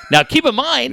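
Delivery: ordinary talk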